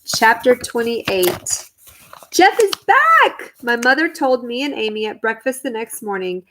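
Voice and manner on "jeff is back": high, slow joyful announcement; excited announcement. Acted. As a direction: burst out with the good news. Mother announces son's return.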